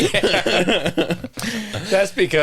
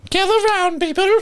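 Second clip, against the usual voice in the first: town crier call; 14 semitones higher than usual. Pretend. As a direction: play an old town crier summoning everyone. Mimics Jeff reading news.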